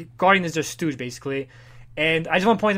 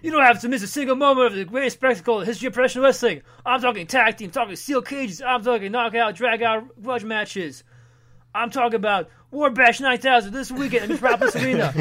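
fast, high hype announcer; wrestling ad announcer; 5 semitones higher, 4 dB louder than usual. Retold or imitated. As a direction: sell the event breathlessly. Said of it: "it's a wrestling ad".

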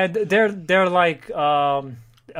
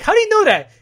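surprised shout; 9 dB louder than usual. Acted. Voicing a comic character's line.

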